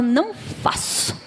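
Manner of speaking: slow, hushed mock refusal; stubborn refusal. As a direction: drag out a soft, stubborn no.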